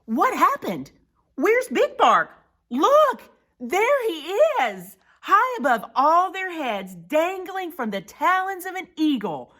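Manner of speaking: high, loud, excited exclaiming; alarmed storytelling. Acted. Prairie dogs spotting Big Bark high above, alarmed. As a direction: point out the danger in amazement.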